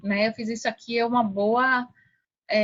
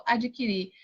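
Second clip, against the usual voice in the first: normal talking voice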